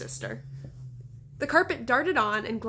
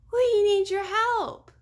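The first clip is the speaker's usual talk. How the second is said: much higher, slow, loud plea; little girl pleading; 10 semitones higher, 7 dB louder, much slower than usual. A narrator's reading. Little girl begging the prisoner, high and urgent. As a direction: beg him for help. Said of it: "Daphne cried".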